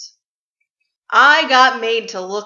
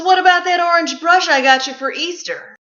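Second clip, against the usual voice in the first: mock mom; 7 semitones higher, 6 dB louder than usual. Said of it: "And she goes".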